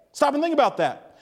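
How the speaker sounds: normal talking voice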